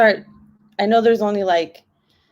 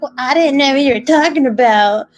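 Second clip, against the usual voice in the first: higher, louder imitation of a sister protesting; mock defensive; 7 semitones higher, 5 dB louder than usual. Imitating sister excusing her mistake, mock defensive. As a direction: voice her protest in a raised pitch.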